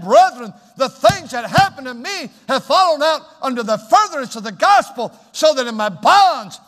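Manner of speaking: loud, high, driving sermon delivery; full-force preaching. Real, not acted. Preacher proclaims Paul's joy in suffering to the congregation, full-force preaching. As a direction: proclaim it in high, punching bursts.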